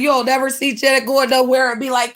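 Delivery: ordinary talk, nothing marked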